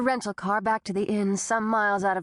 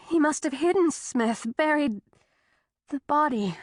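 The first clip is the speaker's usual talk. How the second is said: higher worried woman; Tessa voice; 6 semitones higher than usual. A narrator's reading. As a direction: pitch up, anxious and insistent.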